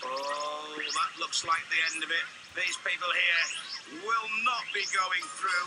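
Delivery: normal talking voice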